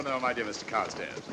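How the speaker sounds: normal talking voice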